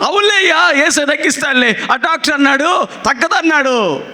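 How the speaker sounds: put-on high, dismissive brush-off of an old woman; imitating a caller who trusts the doctor over Jesus; sceptical caller. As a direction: wave away the pastor's comfort. Caller dismisses pastor's faith.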